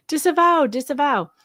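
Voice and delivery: high, mocking singsong. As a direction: mimic them scornfully. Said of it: "That's like a big joke".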